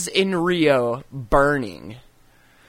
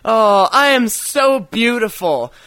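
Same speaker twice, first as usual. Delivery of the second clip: mock vain; 5 semitones higher, 4 dB louder than usual. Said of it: "I'm like".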